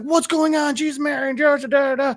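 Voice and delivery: high-pitched panicked babble; mock freak-out. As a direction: imitate a man freaking out, voice way up. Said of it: "freaking out".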